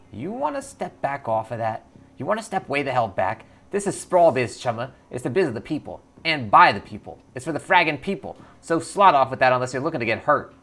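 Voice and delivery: threatening orc clocker. Acted. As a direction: back them off hard, then settle down. Clocker turns threatening, then calms.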